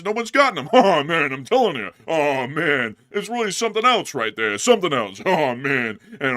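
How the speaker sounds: loud, high, exasperated stand-up comic; Rodney Dangerfield voice. Acted. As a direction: complain like an old-school comic. Comedian bit, "no respect.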